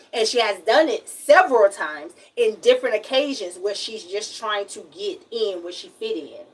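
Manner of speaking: usual speaking voice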